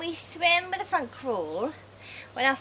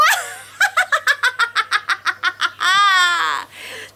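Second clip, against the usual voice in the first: loud high whooping laugh; joyful shrieking laugh; big laugh; 9 semitones higher, 7 dB louder than usual. Pretend. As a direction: burst out laughing with glee. Laughter yoga, pretend diving.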